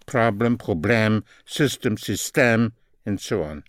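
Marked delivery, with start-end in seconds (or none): 0.6-1.2 "problème" with final "ème" sounded as "emm"; French -ème ending. Shown as a demonstration. Teacher comparing English and French word endings, "problème" ending in "emm". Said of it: "The E Ms are the same". 2.0-2.7 "système" with final "ème" sounded as "emm"; French -ème ending. Shown as a demonstration. Teacher comparing English and French word endings, "système" ending in "emm". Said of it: "The E Ms are the same".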